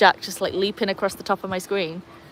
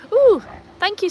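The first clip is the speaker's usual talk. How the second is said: delighted thanks; 13 semitones higher, 5 dB louder than usual. Real, not acted. Thanking a viewer for a gift.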